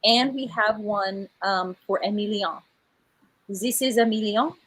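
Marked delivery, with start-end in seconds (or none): 3.5-4.6 lightly put-on French accent. Author presenting her French character to viewers, light French accent. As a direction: introduce him with a touch of French. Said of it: "He is a Frenchman".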